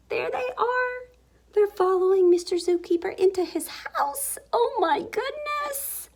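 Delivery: very high, squeaky amazement; high-pitched storyteller's shock; amazed discovery. A narrator's reading. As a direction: gasp and squeal it in astonishment. Animals follow him into house.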